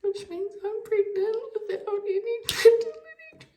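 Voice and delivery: high and loud, near tears; happy shock spilling out; overjoyed. Real, not acted. Overjoyed, tearing up.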